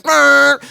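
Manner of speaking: loud high honk; sudden mock horn noise; imitated car horn. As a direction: blare like a taxi horn. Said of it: "There's a fucking taxi cab behind us, just".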